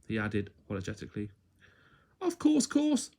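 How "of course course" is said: put-on much higher, bright little girl voice; sing-song high twin with a smile; smiling high little twin. A narrator's reading. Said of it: "smiled Little Miss Twin".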